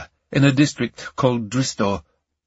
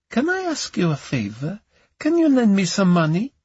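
high-pitched sweet coaxing; sweet, seductive; 9 semitones higher than usual. Acted. Camellia asks Ion for a favor, sweetly seductive. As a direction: wheedle seductively in a higher woman's voice. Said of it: "switched into a tone of voice he knew well, the sweet, seductive Camellia".

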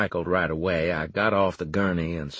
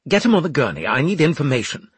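raised, louder commanding character voice; urgent officer's order; 6 semitones higher, 6 dB louder, much faster than usual. A narrator's reading. Officer to medics over a revived man, urgent order. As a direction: order them urgently, voice lifted.

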